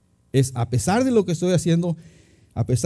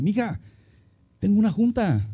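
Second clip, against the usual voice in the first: retold alarm; 5 semitones higher than usual. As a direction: call to your wife, mildly acting out the fright. Retells alarmed cry to his wife. (apurado, con voz más aguda, imitándose)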